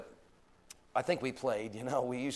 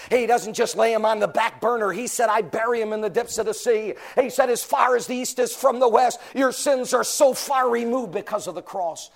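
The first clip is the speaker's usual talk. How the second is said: loud, high preacher's cry; preaching at full force; 6 semitones higher, 10 dB louder than usual. Acted. Preacher lifting the congregation at full force. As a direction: preach it out, climbing hard.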